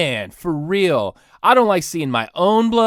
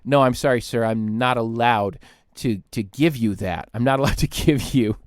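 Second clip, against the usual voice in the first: lower staff voice; 6 semitones lower than usual. Retold or imitated. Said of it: "they say".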